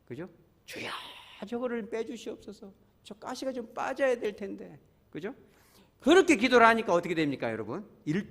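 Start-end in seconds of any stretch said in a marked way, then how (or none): 0.6-4.8 high, soft acted plea to God; pleading prayer. Pastor models intercession for a hurting member, soft high plea. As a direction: pray gently on another's behalf, pitched up. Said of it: "우리가 기도를 그걸 빼줘야".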